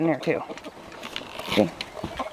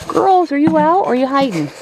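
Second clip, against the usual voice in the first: calling out; 7 semitones higher, 3 dB louder than usual. Real, not acted. Calling to hidden hens.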